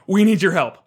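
desperate goblin plea; begging goblins. Retold or imitated. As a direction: beg the heroes for help.

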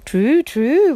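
high, loud owl hoot; swooping storybook owl call; whirring owl. A narrator's reading. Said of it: "whirred the owl".

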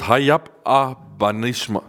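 Hayap Abanishma spelled out slowly, syllable by syllable; slow, deliberate Hayap Abanishma; careful Hebrew phrase. Shown as a demonstration. To the congregation, slowly sounding out Hayap Abanishma. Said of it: "difficult word to say this".

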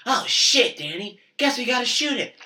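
imitating Joe, high and very loud; loud blurting. Joe answering Danny's whisper about the buck, shouting. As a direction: blow the hushed moment with a shout.

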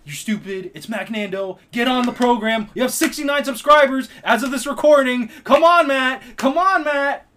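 loud, high-pitched comic rant; angry yell. Real, not acted. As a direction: yell at a co-host to get with it, building to full volume. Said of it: "We got him to yell".

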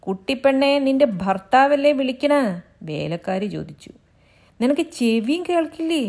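high, loud maid yelling; calling out. Acted. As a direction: shout at the girl who won't come. Maid shouts at hiding girl.

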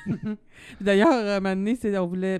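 talking through a laugh; giggling as she speaks. Real, not acted. Laughing while telling the story.

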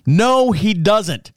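slow, deliberate "No, he doesn't"; drawn-out denial. Retold or imitated. Driver slowly spelling out his denial to the man. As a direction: stretch each word out stubbornly. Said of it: "And I'm doing the finger thing".

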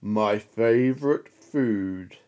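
low, slow monster voice; Gruffalo. Acted. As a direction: growl it out slowly and hungry. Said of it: "the Gruffalo said".

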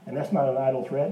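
ordinary talk

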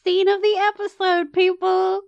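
high hyped announcement; excited. Real, not acted. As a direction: hype the crowd up. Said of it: "Get excited!".

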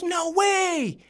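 high-pitched exaggerated disbelief; mock amazement. Acting out Rich's amazed reaction.

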